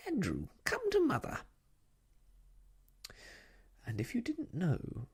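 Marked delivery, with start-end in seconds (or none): 0.1-1.4 very high, cooing call; sing-song, high-pitched summons to a darling; Miss Lark calling. A narrator's reading. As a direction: trill it out like a doting mother. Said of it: "in a very loud voice".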